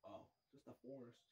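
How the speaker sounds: usual speaking voice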